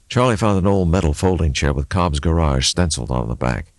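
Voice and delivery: ordinary delivery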